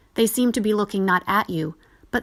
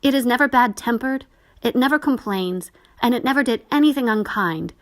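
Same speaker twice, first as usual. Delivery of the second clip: tearful; 6 semitones higher, 3 dB louder than usual. Acted. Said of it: "bursting into tears".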